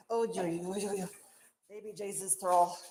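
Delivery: ordinary talk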